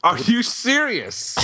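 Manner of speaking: high, drawn-out disbelief; incredulous. Real, not acted. Guest hears of a film credit under their name, incredulous. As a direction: stretch it out, voice leaping up in shock.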